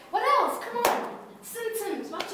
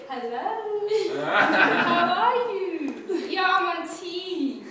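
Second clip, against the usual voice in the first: slow, drawn-out mushy phone voice; lovesick caller; 2 semitones higher, much slower than usual. Acted. Lover phoning on a coffee break, mock lovesick drawl. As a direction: stretch each word out, hamming up the fondness.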